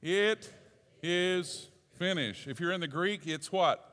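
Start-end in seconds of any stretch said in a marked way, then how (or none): 0.0-2.5 each word drawn out slowly for the congregation to repeat; drawn-out congregational chant; slow call and response. Shown as a demonstration. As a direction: lead the room through it slowly, higher. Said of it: "Say it with me".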